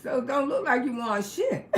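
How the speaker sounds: ordinary talk, nothing marked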